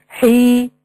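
حي as one long held ee, hii; madd with ya. Shown as a demonstration. Breaking the word down for students, ya lengthened. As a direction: hold the ee of حي. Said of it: "يبقى مد بالياء".

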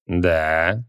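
low, satisfied yes; contented. Acted. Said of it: "довольно подтвердил маг".

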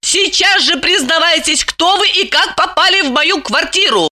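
high, angry woman's voice; menacing demand. A narrator's reading. Lipochka advances on Roman demanding answers, menacing. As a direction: order him up in a high, angry voice. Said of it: "Липочка грозно двинулась на Романа".

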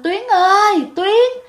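very high-pitched call of a name; retold calling out. Neighbour calling the lost girl, retold high call. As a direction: imitate a neighbour calling someone missing. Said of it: "cái bác này mới kêu lên".

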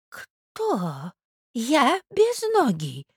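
faint start rising high; bewildered old prince. Acted. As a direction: ask in drawn-out, hurt disbelief. (старый князь, растерянно и протяжно)

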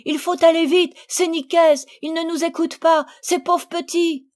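high, loud, rushed panic; breathless and agitated. Acted. The three girls bursting in to their mothers. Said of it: "moins essoufflée".

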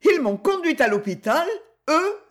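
high, incredulous cry; mocking disbelief. A narrator's reading. Suspect ridiculing the soldiers' testimony, mocking disbelief. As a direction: leap up in pitch as if about to laugh. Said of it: "cria Elsa en éclatant de rire".

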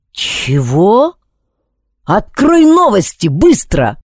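sharp, loud, high command; angry demand. Acted. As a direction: bark the order at the phone. Yelling at phone assistant.

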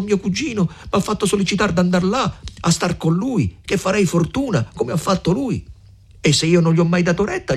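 put-on a young suitor dreaming aloud, voice lifted; Renzo, hopeful. A narrator's reading.

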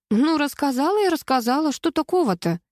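high, breezy brush-off; carefree. A narrator's reading. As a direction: toss it off as no big deal. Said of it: "беспечно пожала плечами Светка".

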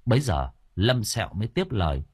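ordinary delivery, nothing marked

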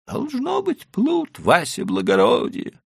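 put-on high, pleading old merchant. A narrator's reading. Leiba answers.

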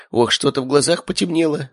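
high-pitched ailing uncle; feeble mumbling. A narrator's reading. Uncle claiming to feel faint, weakly mumbling. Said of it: "пробормотал он".

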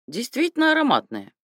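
put-on high lisping old man's praise; lightly voiced approving old general; old man praising. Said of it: "похвалил Андрей Тарасович".

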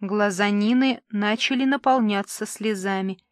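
plain reading voice

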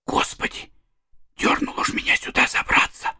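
terrified hushed whisper; breathless scared whispers; whispering while hiding. A narrator's reading. Boys terrified hiding upstairs.

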